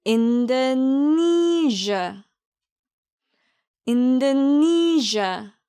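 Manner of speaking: slow 인도네시아 as "in-duh-NEE-zhuh"; measured repeat-after-me 인도네시아, long NEE; pronunciation drill. Shown as a demonstration. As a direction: draw out the stressed NEE in 인도네시아 for learners to repeat. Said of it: "그럼 같이 발음해 볼까요".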